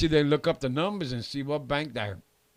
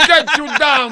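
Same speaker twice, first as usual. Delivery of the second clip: laughing hype man; 11 semitones higher, 9 dB louder than usual. Pretend.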